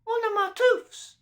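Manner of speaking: put-on a baby cooing over his tooth; gurgling baby. A narrator's reading. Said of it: "gurgled Baby Rottentot".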